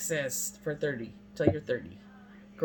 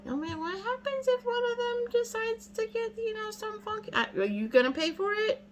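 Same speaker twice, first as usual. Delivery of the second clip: much higher, mock anxious squeal; mock worried voice. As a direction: play up the panic in a high voice. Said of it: "That's what I told my doctor".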